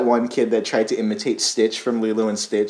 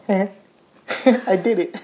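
slow, high, timid villain gloating; a meek villain savoring his win; unintimidating villain; 9 semitones higher, much slower than usual. Pretend. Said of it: "this is my evil laugh as a guy who's not very intimidating".

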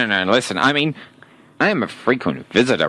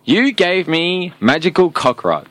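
normal talking voice

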